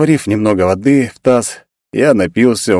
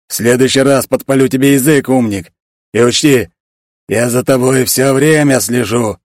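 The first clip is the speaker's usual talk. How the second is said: angry genie; 4 semitones higher than usual. Acted. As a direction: threaten him like a fiery spirit. Angry genie threatens narrator.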